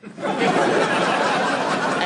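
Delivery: long breathy chuckle; amused laughter at the sea lion; real laugh. Real, not acted. Laughing after sea lion line.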